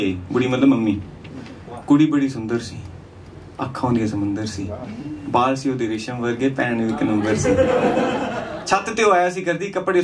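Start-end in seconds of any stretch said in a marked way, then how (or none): none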